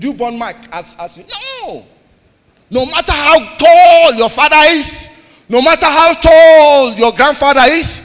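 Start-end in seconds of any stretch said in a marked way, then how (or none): 2.7-8.0 loud and slow, pitch up high on tall; preaching shout. Real, not acted. Preacher booming at the church that growth is personal. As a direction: thunder it at the congregation.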